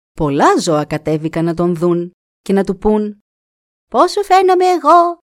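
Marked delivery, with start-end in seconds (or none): 3.9-5.2 high, loud animal call. Acted. Animals calling to the raven, high and loud.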